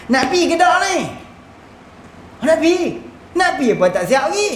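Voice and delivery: high-pitched, exasperated challenge; acted impatience. Traveller scolding a companion who has not packed, impatiently.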